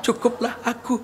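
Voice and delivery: soft, halting mock lament; mock suffering wife. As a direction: play a long-suffering wife pouring out her misery. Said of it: "Tiba-tiba perempuan ini apa?". (ratapan istri menderita yang dibuat-buat)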